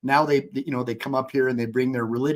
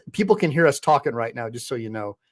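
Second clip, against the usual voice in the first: ordinary talk, nothing marked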